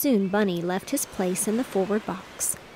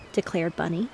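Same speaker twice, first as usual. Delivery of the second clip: ordinary delivery, nothing marked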